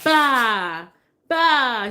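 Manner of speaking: imitating baby babble. Mom imitating her baby saying ba. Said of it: "so he'll say".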